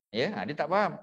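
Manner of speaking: ordinary delivery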